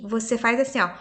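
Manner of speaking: normal talking voice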